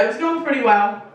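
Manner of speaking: ordinary talk, nothing marked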